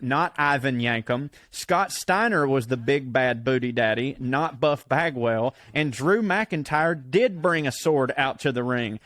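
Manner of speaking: ordinary talk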